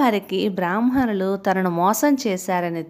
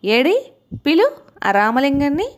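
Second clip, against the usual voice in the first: higher, louder kingly command, amused yet cross; a king barking for his jester, half laughing; king demands Ramalingam, angry but amused; angry royal summons; 5 semitones higher, 3 dB louder than usual. A narrator's reading. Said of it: "నవ్వు, కోపం రెండు వచ్చాయి".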